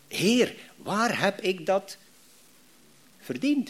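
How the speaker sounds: slow, drawn-out plaintive whine to God; mock-whine the complaint, very slowly; complaining prayer. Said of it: "wij zeggen dat soms". (traag klaaglijk gebed)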